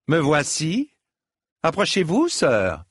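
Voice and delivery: high, commanding summons; calling out. A narrator's reading. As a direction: call him over across the water. Lady calls knight across the water. (appel impérieux)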